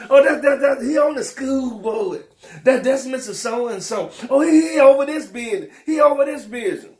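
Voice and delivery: mock awe. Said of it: "And you all say".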